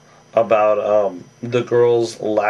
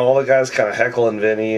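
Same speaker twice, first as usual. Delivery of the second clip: ordinary talk, nothing marked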